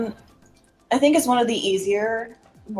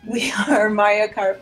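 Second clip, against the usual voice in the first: laughing through a tinny mic; cheap usb mic. Real, not acted. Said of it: "I apologize for my mic quality".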